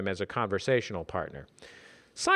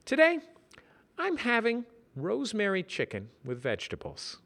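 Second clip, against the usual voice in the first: raised, pleased announcement; Simon voice; 10 semitones higher, 4 dB quieter than usual. Acted. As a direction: announce it with quiet pride.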